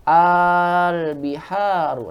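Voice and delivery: "Al biharu" with a very long held opening aa; long merged hamzah. Shown as a demonstration. Teacher demonstrating the question form, "Al biharu" with drawn-out first a. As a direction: stretch the first vowel of "Al biharu" slowly. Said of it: "digabung menjadi hamzah panjang".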